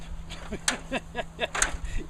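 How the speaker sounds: short high laugh at himself. Real, not acted. After missing the ladder's bottom step, a sheepish laugh.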